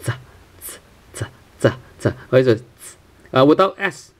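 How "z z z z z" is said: Z said as a sharp ts; ts sound. Shown as a demonstration. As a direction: say Z like t and s together. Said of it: "something like a T S sound".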